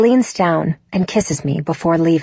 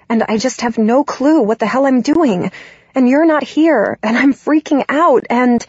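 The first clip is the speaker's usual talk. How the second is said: rambling panic; 5 semitones higher than usual. Acted. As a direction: spill it out faster and higher as she loses it. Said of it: "I just start rambling uncontrollably".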